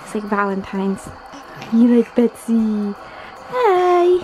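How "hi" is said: put-on baby talk. Voicing doll to baby.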